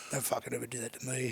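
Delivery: low, soft husky growl of a threat, imitating a teammate. Teammate threatening the prankster, in an imitated husky voice. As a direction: gruffly warn him off in a husky mock voice. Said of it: "with his husky voice".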